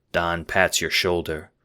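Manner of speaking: ordinary delivery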